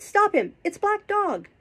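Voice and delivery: high, loud cry of alarm. A narrator's reading. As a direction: raise the alarm, pitch shooting up. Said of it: "I cried".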